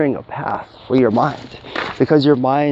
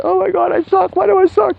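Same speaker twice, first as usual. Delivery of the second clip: much higher, whiny mock voice; whiny self-pity. Mocking a client moaning to an expensive therapist, whiny and high. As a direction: wail it like a pitiful therapy client. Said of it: "talk to someone who's ten thousand dollars and say, like".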